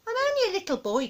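put-on high, quick child voice; high-pitched, hurried excuse; pleading little boy. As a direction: protest in a small boy's voice. Small boy pleads with grandma.

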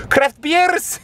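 high, drawn-out silly shout; playful cheer. Pretend. Vlogger praising the store's selection, playful cheer. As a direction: burst into a mock-excited squeal.

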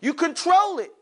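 usual speaking voice

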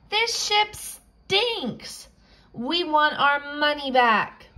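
high, slow outraged passenger; high, slow complaint. Acted. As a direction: whine it out high and drawn.

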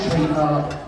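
ordinary delivery

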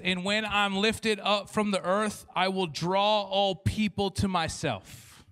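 slow, loud reading of the verse; preaching scripture. Acted. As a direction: proclaim it slowly and loudly.

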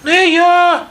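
very high squeaky mouse voice; little mouse refusing. A narrator's reading. Mouse refuses the rooster's chore, squeaky voice. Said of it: "каже й друге мишеня".

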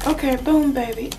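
ordinary talk, nothing marked

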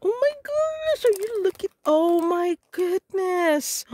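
thrilled squeal. Real, not acted. Crafter opening a box from Kim, squealing with delight. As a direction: squeal with real joy at the gift.

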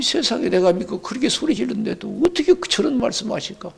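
normal talking voice

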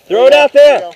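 loud call across the field; shouting. Real, not acted. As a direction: yell it out to a teammate. Urging a teammate in paintball.

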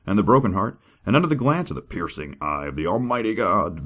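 grand, high dramatic reading; scripture reading. Acted. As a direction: declaim it like a preacher.

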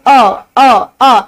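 अ as a separate open uh; अ demonstrated as a bare vowel; isolated vowel sound. Shown as a demonstration. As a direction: sound out अ on its own, repeated. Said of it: "आपकी अ sound".

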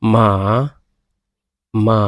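মা with Chinese tones; tone demo. Shown as a demonstration. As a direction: model the tone on মা. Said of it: "এরপরে হলো".

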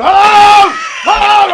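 loud, high hello shouts; calling out. Retold or imitated. Yelling at the locked gate, loud call. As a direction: call out across the yard.

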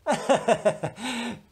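mocking laugh. Scornful laugh.

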